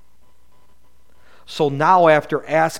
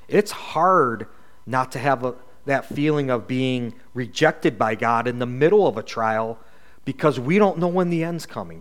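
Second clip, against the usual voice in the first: ordinary delivery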